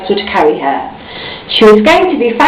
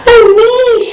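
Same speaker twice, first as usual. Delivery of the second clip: gasped; 14 semitones higher than usual. A narrator's reading. Miss Star sees her own book, a high gasping cry. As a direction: cry out, overwhelmed. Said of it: "she gasped".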